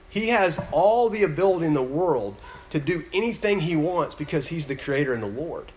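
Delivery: ordinary talk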